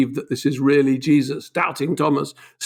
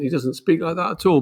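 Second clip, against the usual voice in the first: normal talking voice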